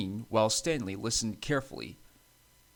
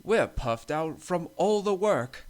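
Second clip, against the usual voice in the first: high, loud, slow complaint; puffed-out engine; 7 semitones higher, 5 dB louder, slower than usual. A narrator's reading. Bertram backing up a complaint about work, puffed-out voice. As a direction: drag it out, high and worn out.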